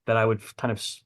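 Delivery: usual speaking voice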